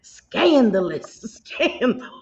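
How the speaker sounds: stretched-out Southern vowels, tipping into laughter; milk the word slowly in a Southern drawl; exaggerated Southern drawl. Echoing a friend's mock outrage at gossip, playful Southern drawl.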